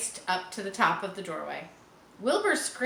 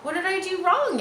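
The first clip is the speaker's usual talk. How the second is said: higher, louder puzzled pig voice; 9 semitones higher, 5 dB louder than usual. A narrator's reading. Pig asking the spider after his fall, puzzled. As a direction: ask it like a bewildered young pig.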